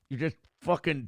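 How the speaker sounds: usual speaking voice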